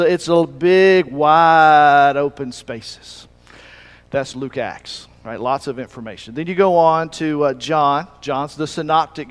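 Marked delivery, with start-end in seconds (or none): 0.0-3.3 very slow, louder, stretched-out Southern drawl; Savannah drawl. Shown as a demonstration. Preacher demonstrating the drawl to the congregation, slow and loud. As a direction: draw out wide open spaces as far as it goes. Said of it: "draw out what they're saying".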